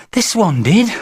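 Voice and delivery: put-on high child's protest; high-pitched insistence; insistent small boy. A narrator's reading. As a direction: contradict like an indignant little boy. Boy contradicts his mother.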